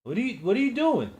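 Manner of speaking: high, fast panicked shout; frantic yell. Acted. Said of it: "I yelled out frantically".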